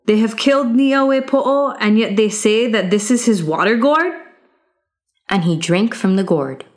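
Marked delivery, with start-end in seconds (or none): 0.1-4.1 higher, incredulous retort; young hero scoffing. Acted. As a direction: throw their words back at them. Youth scorns the guards' answer.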